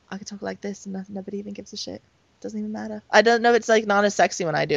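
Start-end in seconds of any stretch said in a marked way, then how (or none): 0.1-3.0 very soft British accent demo. Shown as a demonstration. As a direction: try a British accent, hushed. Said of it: "do it in your British accent".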